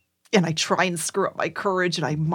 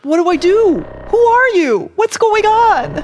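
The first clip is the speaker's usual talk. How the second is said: high, loud panicked questions; frightened cry for answers; 12 semitones higher, 9 dB louder than usual. Acted. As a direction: voice climbing in panic, slowing on the last.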